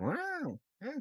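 very high, slow silly cartoon voice; squeaky water drop character; vintage cartoon voice. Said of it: "when you're trying to do a cartoon voice".

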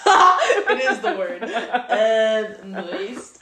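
laughing. Real, not acted. Agreeing with a friend on the word, laughing. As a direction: talk through a burst of laughter.

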